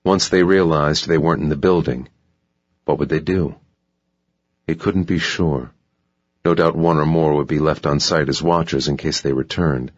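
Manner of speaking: ordinary talk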